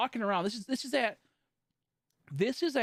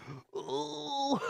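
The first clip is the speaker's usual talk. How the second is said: high acted gasp, drawn out; panicked gasp; 9 semitones higher, 4 dB quieter than usual. Sitting with chest feeling weird, panicked gasp. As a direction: suck in air as if alarmed.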